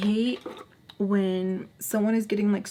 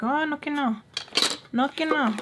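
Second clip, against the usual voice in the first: mock taunt; 7 semitones higher than usual. Mocking look at friend in retold moment.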